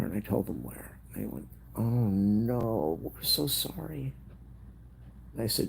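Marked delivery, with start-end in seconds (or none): 1.7-4.1 high, slow imitated condolence; pitched-up retold sympathy; sympathetic dismay. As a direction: echo their sorrow, pitched up and drawn out. Vet staff offer condolences.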